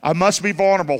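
said out loud. Shown as a demonstration. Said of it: "Say it out loud".

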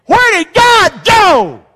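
high, loud, drawn-out cry to God; desperate full-voice holler; anguished shout. Shown as a demonstration. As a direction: shout it slowly, every word punched. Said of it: "You ever said it like that?".

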